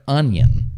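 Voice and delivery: onion run together as one word, UN-yun; joined-up model onion; onion blended. Shown as a demonstration. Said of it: "It's together".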